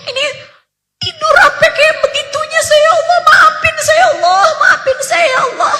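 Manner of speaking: high, sobbing plea for forgiveness, rising to cries; tearful prayer. Retold or imitated. Father begging God's forgiveness for missing tahajud, sobbing high. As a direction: beg God through tears. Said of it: "nangis terisak-isak".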